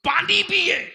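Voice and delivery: high, loud oratorical shout; full-force preaching. Real, not acted. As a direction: challenge the crowd at the top of your voice.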